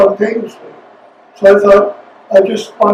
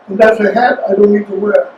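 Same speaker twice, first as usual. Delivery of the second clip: ordinary talk, nothing marked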